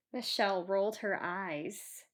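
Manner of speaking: ordinary delivery, nothing marked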